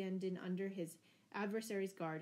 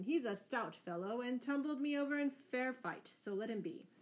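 ordinary delivery, nothing marked

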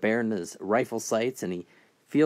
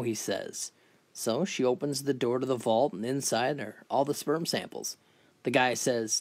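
usual speaking voice

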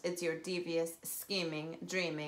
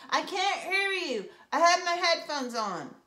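high, loud slow shout; yelling over headphones; 10 semitones higher, 7 dB louder than usual. Acted. Brother with headphones yelling back at sister. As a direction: yell like you can't hear yourself. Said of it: "he yelled".

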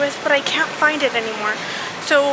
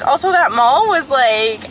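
ordinary talk

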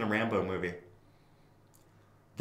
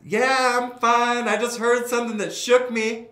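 much higher, louder shouted reply; calling back; 13 semitones higher, 9 dB louder than usual. Retold or imitated. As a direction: shout back across the field, reassuring. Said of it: "And I'm like".

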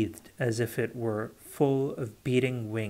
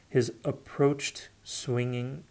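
ordinary delivery, nothing marked

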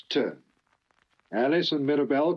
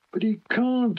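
objecting; 12 semitones higher than usual. Acted. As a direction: protest in disbelief. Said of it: "objected Duck".

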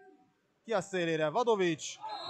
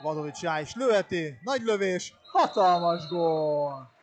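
excited goal call; 3 semitones higher, 7 dB louder than usual. Real, not acted. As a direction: build it up and explode on the goal. Goal call in water polo. (izgatott gólkiáltás)

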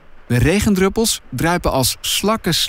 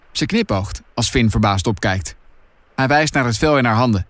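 ordinary delivery, nothing marked

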